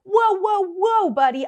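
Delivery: high, loud startled push-back; defensive whoa. Retold or imitated. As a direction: throw your hands up, stop him cold. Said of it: "start getting defensive and be like".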